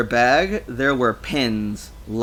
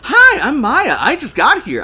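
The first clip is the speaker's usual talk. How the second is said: high, loud, bubbly hello; bright eager greeting; 11 dB louder than usual. A narrator's reading. Maya introducing herself to a foreigner, loud and eager.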